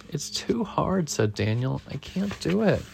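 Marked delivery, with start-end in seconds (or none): 0.1-1.1 high, soft, slow complaint; small struggling kid voice; discouraged child. A narrator's reading. Daniel struggles up the hill, high soft complaint. 1.9-2.8 high, soft little whine; discouraged child. A narrator's reading. Daniel tells Dad he's stuck, high soft defeat.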